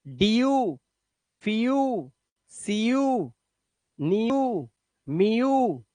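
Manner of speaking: डीयू, फीयू said as dee-yoo; teacher models the ईयू sound; model words. Shown as a demonstration. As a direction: drill each ईयू word distinctly. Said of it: "जब E W आ जाए तो".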